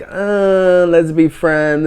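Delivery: high, slow awkward brush-off; awkward letdown voice. Retold or imitated. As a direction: play the hesitant let-them-down-easy. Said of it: "There was no like".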